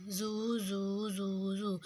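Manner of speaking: زو drawn out very slowly, every ز said the same; very slow stretched زو; wrong zu. Shown as a demonstration. Teacher showing the wrong reading, slowly stretched. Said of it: "جو کہ غلط ہو جاتا ہے".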